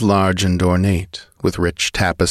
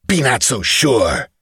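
higher-pitched menacing retort; defiant threat; 5 semitones higher than usual. A narrator's reading. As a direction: warn the master off, in character. Slave's defiant threat to master.

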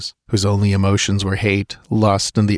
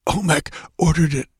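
higher, weak gasping voice; gasping dying man; 7 semitones higher than usual. Acted. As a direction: gasp it out with failing breath. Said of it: "gasped the dying man".

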